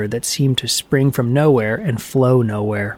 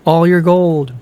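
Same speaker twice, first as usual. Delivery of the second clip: higher, ringing cavern boom; booming echo; 5 semitones higher, 4 dB louder than usual. A narrator's reading. The cavern echoes Loki's demand, booming and pitched up. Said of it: "boomed the cavern".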